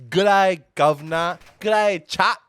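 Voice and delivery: loud, high hammed-up British greeting; attempted British accent. Co-caster trying a British accent for the chat, loud and hammy. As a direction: belt out a bad British "g'day", drifting Australian. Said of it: "are you any good at British accents".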